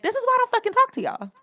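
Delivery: high, fast, loud retort; exasperated outburst. Retold or imitated. Fed-up retort to dismissive family, exasperated. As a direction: fire back in fed-up frustration.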